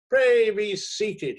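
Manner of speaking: high, loud and slow mock courtesy; hearty editor. A narrator's reading. Editor to the stunned critic, loud mock politeness. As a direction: bellow the politeness grandly. Barnaby flings him into chair.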